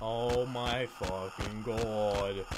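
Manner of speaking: slow, drawn-out, low exasperation; slow and low, fed up; exasperated groan. Real, not acted. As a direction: drag each word out in weary disbelief. Not finding a key.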